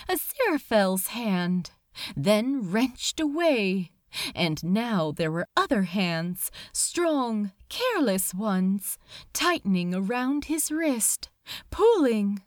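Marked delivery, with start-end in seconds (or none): none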